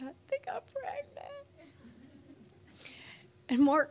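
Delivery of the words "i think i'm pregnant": tiny, very high mock crying; imitated bawling. Crying woman confiding in friend at church, high mock whimper. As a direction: squeak it out softly as if sobbing. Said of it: "I am bawling".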